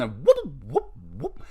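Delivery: lightly voiced Three Stooges whoop, fading off. Fan imitating a Three Stooges poke, comic whoop.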